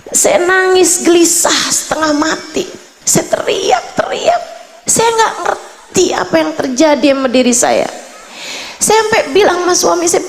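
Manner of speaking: high-pitched, desperate pleading; retelling a woman's frantic despair; retold distress. Retelling woman's desperate crying plea.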